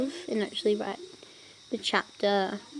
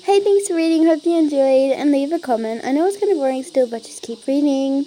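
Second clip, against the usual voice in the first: bright author's note; 4 semitones higher, 9 dB louder than usual. Acted. Reading author's note aloud.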